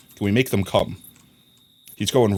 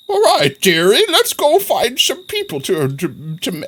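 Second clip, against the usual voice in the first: put-on high, creaky old-lady voice; high-pitched mock grandma; playful grandma voice; 9 semitones higher than usual. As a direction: put on a high doddering granny. Playing the grandma he became.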